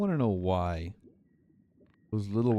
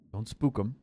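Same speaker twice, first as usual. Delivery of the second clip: usual speaking voice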